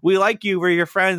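high, gushing group-of-friends voice; mock chorus of sympathetic friends; imitated friendly reassurance. Friends reassuring Wart, acted out.

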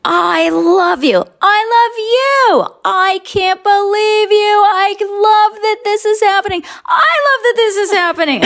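overjoyed yelling as if a big fan; Jerry yelling his delight at meeting her; excited shouting. Retold or imitated. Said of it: "all I hear is".

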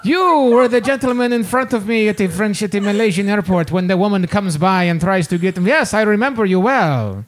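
hammed-up French accent. Frenchman recognizes a man from the airport, mock French accent. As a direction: imitate a Frenchman speaking English, imperfectly. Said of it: "but he had a French accent".

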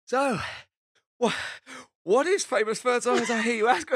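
imitating a winded, high-pitched host; mock-breathless show intro, broken and high; out of breath. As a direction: gasp it out loudly between pauses. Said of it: "he was so out of breath".

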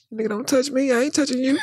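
shrieking high put-on voice; mock high shout. Pranking the frowning man, high mock shout. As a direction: yelp it high like a kid.

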